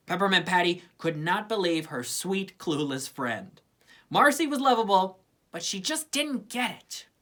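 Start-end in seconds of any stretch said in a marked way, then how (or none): none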